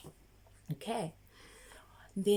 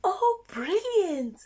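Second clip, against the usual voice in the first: high, loud, delighted praise; excited praise; 9 semitones higher, 5 dB louder, slower than usual. Acted. Teacher delightedly praising a child's answer. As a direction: burst out with delight.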